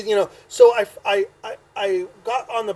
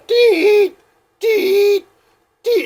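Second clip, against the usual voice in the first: high, loud imitated keypad beeps; dial tone beeps; 8 dB louder, much slower than usual. Drunk man dialing a long number, imitated as shrill beeps. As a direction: beep like a phone being dialed.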